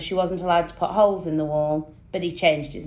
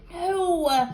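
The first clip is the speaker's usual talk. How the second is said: ordinary delivery, nothing marked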